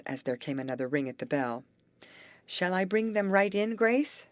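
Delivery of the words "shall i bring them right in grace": high, louder call over the shoulder; calling to a friend across the room. A narrator's reading. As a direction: call back toward the room, rising on Grace. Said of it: "she called over her shoulder".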